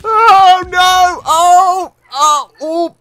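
high, loud panicked oh; alarmed cries. Real, not acted. Rider crashing into cannon fire, alarmed cries. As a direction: cry out as you crash.

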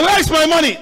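high, loud acted demand for money; angry demand. Servant confronts his fellow servant over money, angry demand. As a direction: confront the debtor at full voice.

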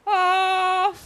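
loud, high drawn-out "oh"; high yell of dismay; startled cry. Real, not acted. Startled cry during gameplay.

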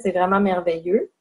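normal talking voice

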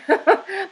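short high sheepish laugh; embarrassed laugh. Real, not acted. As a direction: laugh off her own harsh words. Laughs at own harsh remark.

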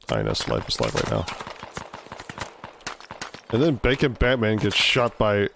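ordinary delivery

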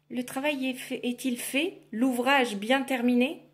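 lightly voiced Baba Yaga; demanding witch. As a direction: probe like a cranky old witch.

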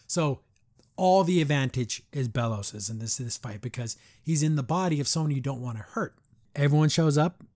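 ordinary delivery

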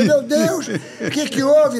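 high, slow alarmed cry; mock panic. Pepita seeing her husband thrown to the floor, mock panic. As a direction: gasp it out in alarm.

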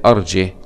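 أرجه without hamza, closing on "h"; no vowel after the haa of أرجه; haa with sukun. Shown as a demonstration. Teacher repeating the reading, أرجه ending "jih" with sukun. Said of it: "بدون همز وسكون الهاء".

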